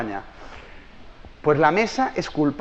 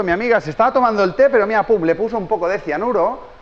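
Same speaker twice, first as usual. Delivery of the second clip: ordinary talk, nothing marked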